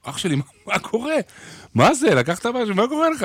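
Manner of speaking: high, incredulous bandmate asking what's going on; a lightly voiced astonished guitarist; guitarist's amazed question. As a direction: play the stunned guitarist turning round mid-song. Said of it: "אומר לי".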